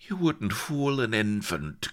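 high-pitched jeer; scornful cry. A narrator's reading. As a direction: cry it out dismissively. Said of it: "cried Blood".

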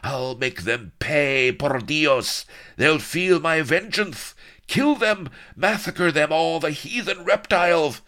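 high, loud, raging villain; frothing rage. Acted. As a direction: rant and spit like a mad tyrant.

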